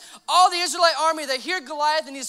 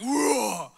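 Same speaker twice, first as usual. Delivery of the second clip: mock monster "rwoar"; scary jump-out roar; 2 semitones lower than usual. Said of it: "it sounded super scary".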